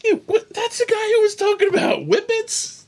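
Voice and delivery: very high, loud squeal of laughing talk; real helpless laughter. Real, not acted. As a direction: squeal the punchline while cracking up. Cracks up telling the punchline.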